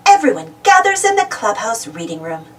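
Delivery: usual speaking voice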